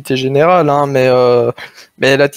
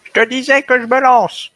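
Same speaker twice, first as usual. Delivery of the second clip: put-on high, creaky old-timer voice; little old voice; 8 semitones higher than usual. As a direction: play a frail little old man. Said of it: "leur petite voix de vieux".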